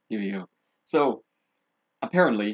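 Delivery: usual speaking voice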